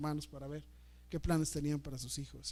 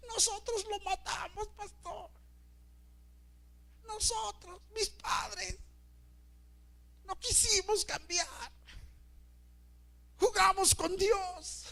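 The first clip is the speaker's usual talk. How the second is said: high, slow, anguished wailing voice; imitated grieving sister crying out to the pastor; slower than usual. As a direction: wail out her grief for her dead brother. Pegando de gritos.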